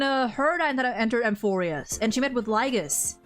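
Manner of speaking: ordinary talk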